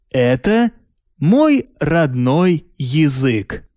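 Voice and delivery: usual speaking voice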